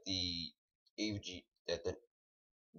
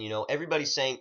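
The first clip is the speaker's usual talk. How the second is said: normal talking voice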